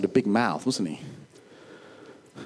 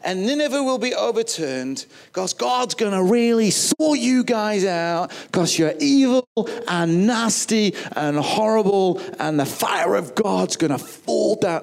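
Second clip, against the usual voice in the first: fiery preaching; 7 semitones higher than usual. Retold or imitated. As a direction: thunder out doom to the city. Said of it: "And he said this".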